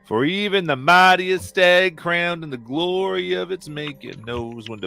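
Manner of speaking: preacher's sermon. Acted. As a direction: lift the flock like a fiery preacher. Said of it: "he goes".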